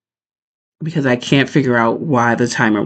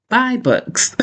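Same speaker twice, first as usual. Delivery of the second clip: much higher, cartoonish squeak; squeaky punchline; 11 semitones higher than usual. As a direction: pop the punchline up high. Punchline of her reading rule.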